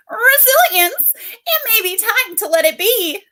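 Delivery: tiny, very high insect voice; squeaky little fly character. Acted. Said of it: "I need Fly's voice in my head".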